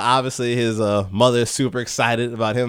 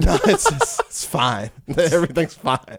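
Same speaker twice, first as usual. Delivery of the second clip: forced everything's fine; 3 semitones higher than usual. Retold or imitated. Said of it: "And he's like".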